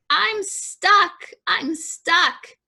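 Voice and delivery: high-pitched storybook distress call; acted high cry of being stuck; little truck crying out. A narrator's reading. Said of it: "cried the little blue truck".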